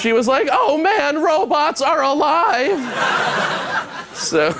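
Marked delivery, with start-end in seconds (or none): 0.5-2.8 high, slow, awed voice; a woman amazed, pitched high; mock amazement. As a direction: gasp at the discovery, hammed up.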